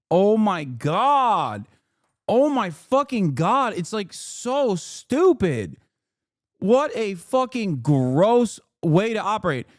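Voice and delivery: drawn-out, high, swooping disgust; real exasperation. Real, not acted. Streamer venting at a politician's gross excuses, exasperated disgust. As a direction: groan it out high and emphatic, utterly fed up.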